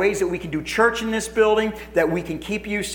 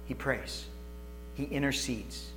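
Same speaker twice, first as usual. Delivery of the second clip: hushed; 4 semitones lower, 15 dB quieter, slower than usual. Real, not acted. Hushed reverent explanation.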